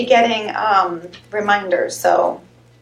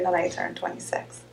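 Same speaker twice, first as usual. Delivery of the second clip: whispered aside; 4 semitones lower, 10 dB quieter than usual. Pretend. Joking about own age.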